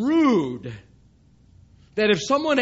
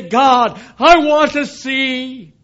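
calling out to God; 4 semitones higher, 8 dB louder, slower than usual. Acted. Sinner calls out to God.

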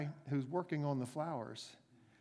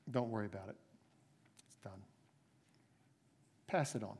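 gentle reassurance; 5 semitones lower, 7 dB quieter, much faster than usual. Acted. Master reassures forgiven servant.

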